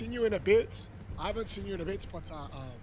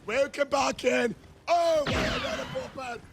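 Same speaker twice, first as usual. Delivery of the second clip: hype shout; 9 semitones higher, slower than usual. Real, not acted. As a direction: yell it out to the chat, pumped up.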